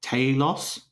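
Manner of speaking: "Talos" said the Australian way, TAY-los; Australian pronunciation. Shown as a demonstration. Guessing how an Australian says it, Australian "Talos". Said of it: "Australian would probably say".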